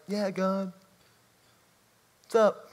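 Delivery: mock-flippant offhand greeting; trite, casual greeting. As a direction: toss it off as if to a buddy. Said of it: "with this kind of trite".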